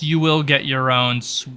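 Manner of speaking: ordinary delivery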